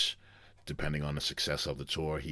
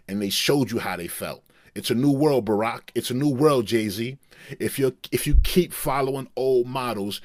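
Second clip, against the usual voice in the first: higher, louder, fired-up [name] rant; [name] impression; 5 semitones higher, 8 dB louder than usual. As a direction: shout down the booing crowd as [name]. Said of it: "[name] just laughed and was like".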